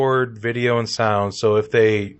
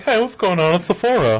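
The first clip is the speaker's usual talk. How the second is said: raised-pitch acted reply; guard replying; 6 semitones higher than usual. Guard Bill coming over to the robot, higher put-on voice. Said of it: "Bill can come in like".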